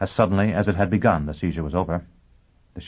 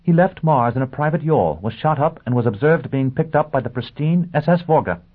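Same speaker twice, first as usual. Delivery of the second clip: urgent excited; 8 semitones higher, 5 dB louder than usual. A narrator's reading. Urgent news report.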